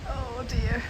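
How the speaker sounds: ordinary delivery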